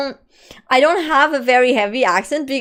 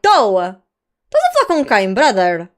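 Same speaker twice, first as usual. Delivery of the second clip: rough northern Portuguese twang; a rough Porto accent, louder; 3 dB louder than usual. Shown as a demonstration. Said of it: "I'm a bit rough".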